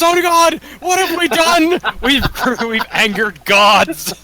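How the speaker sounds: high, loud panicked wail; panicked creature. Pretend. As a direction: cry out like doomed fools.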